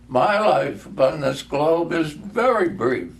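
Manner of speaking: the dying spirit speaks solemnly; solemn ghost. Acted. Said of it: "replied the ghost".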